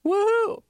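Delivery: high, gleeful woohoo; dog's happy whoop. Retold or imitated. Host voices the delighted dog seeing the cookie guy, high whoop. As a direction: cheer like a dog spotting its treat guy.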